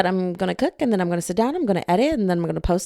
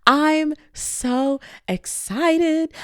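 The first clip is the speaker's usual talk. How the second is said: very slow, high, drawn-out squeal of excitement; excited gush; 7 semitones higher, much slower than usual. Real, not acted. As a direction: stretch every word out in delight. Gushing about the book.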